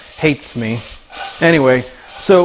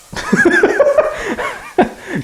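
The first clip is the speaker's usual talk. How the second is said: real high squealing laugh; burst of laughter. Real, not acted. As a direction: crack up suddenly at the dog.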